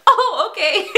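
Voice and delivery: high, loud reply breaking into laughter; amused surprise. Retold or imitated. Band answering her squat request, mimicked laughing surprise. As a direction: mimic being caught off guard and laughing.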